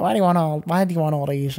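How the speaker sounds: lightly attempted Scottish accent; wobbly mock Scottish, badly faked; terrible Scottish accent. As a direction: have a bad go at a Scottish accent. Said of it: "that was a terrible Scottish accent".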